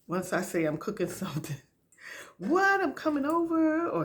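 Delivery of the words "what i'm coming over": high, excited mock friend voice; jumping at the chance for free food; eager friend. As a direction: squeal it like a friend inviting herself over. Friends begging for food, quoted.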